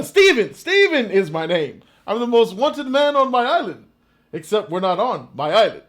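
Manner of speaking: loud, excitable Irish madman; crazy Irishman. Acted. Irish warrior introducing himself to Wallace, crazy Irishman act. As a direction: play a boastful Irish wild man. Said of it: "the crazy Irishman. He's like, uh".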